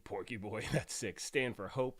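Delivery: usual speaking voice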